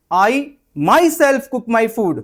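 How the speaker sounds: myself loud and high, slow; with emphasis. Shown as a demonstration. Firmly stressing myself to an annoying friend. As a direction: punch myself. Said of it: "आप अगर ऐसे बोल दोगे ना".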